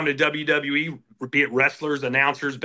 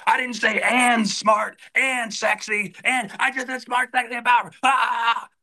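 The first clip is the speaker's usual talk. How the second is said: mock furious boss yell, higher and slowed; Vince McMahon yelling; 8 semitones higher, slower than usual. Announcer mimics Vince screaming at him over a line, mock rage. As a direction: ham up an irate boss chewing out his announcer. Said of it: "he'd go nuclear on you".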